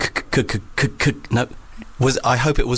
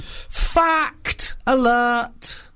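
high, drawn-out jingle call of fact alert; mock announcer; 11 semitones higher, much slower than usual. To co-host, a high slow mock alarm announcement. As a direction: announce it like a cheesy quiz-show jingle.

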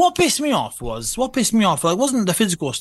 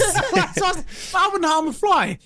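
mock innocent; 12 semitones higher, slower than usual. Real, not acted. Joking protest of innocence.

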